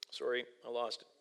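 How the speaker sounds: ordinary delivery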